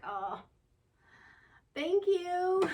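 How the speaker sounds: a moved, surprised thank you; touched reply. Retold or imitated. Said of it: "And I'm like".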